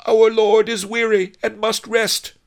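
high, loud proclamation; shouting. A narrator's reading. Noble nurse calls to the major domo, high shout. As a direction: call the order out over the street. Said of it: "Fat Fu shouted".

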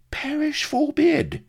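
very high, loud, drawn-out protest; indignant exclamation. A narrator's reading. Vladimir denying Avery put him up to it, indignant. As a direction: cry out as if deeply insulted. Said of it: "exclaimed the Fink indignantly".

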